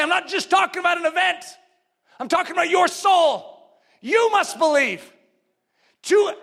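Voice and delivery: high, insistent preacher's voice; forceful preaching. Real, not acted. Preacher urging listeners to believe for themselves, forceful preaching. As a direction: press each phrase on the congregation.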